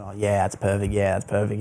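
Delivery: soft, drowsy mumble, barely above a breath; sleepy mirror murmur. Retold or imitated. Young man reading his mirror quote at dawn, soft drowsy murmur. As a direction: murmur it half awake to your own reflection. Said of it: "I'm like".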